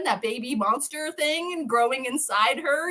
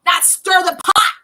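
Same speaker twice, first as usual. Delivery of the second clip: louder, higher mock rebuke; angry Boyd; 6 semitones higher, 8 dB louder than usual. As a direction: scold Ellis for betraying you. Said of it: "Boyd's like".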